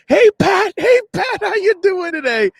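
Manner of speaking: very high, eager fanboy hail; starstruck greeting. Retold or imitated. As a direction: call out to your idol in an overexcited high voice. Retelling his starstruck greeting to Pat.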